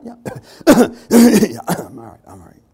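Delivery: hacking cough trailing into a weak reassurance; coughing fit. Retold or imitated. Sick churchgoer coughs and insists he is fine, fading. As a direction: cough loudly, then fade to a faint 'I'm all right'.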